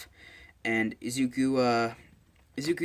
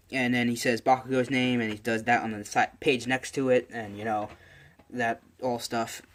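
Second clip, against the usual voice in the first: ordinary delivery, nothing marked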